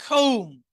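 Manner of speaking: loud, clear কম্ব as "kohm", b silent; silent b in comb. Shown as a demonstration. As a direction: end কম্ব on a held m, without the b. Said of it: "শুধু এই তিনটা উচ্চারণ হবে". (জোরে "কৌম", কম্ব-এর ব অনুচ্চারিত)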